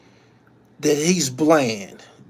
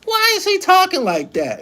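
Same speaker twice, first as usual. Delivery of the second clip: much higher, puzzled mock voice; mock baby mom. Streamer mimicking his baby mom's puzzled question, high mock voice. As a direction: pitch it way up like she asked it. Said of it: "you know what she said?".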